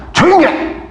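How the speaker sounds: high-pitched mock order to hush; stern rebuke. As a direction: imitate a stern, authoritative telling-off. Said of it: "엄하고 막 권위 있게 막". (엄하게 꾸짖는 흉내)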